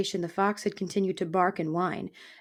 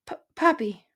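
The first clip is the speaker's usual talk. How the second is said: higher, stammering little mouse; timid mouse; 4 semitones higher, 4 dB louder than usual. Acted. Mouse giving her name to the porcupine, timid stammer. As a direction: stumble timidly on the first sound.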